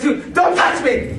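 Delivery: high, slow yelp of don't touch me; recoiling yelp. Retold or imitated. Friend recoiling from the bloodied teller. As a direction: pull away from him.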